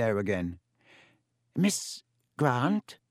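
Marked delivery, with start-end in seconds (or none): 1.5-3.0 put-on dry wheezy old teacher, questioning; Professor Binns, puzzled. A narrator's reading. Ghost teacher noticing the waving hand, wheezy and puzzled.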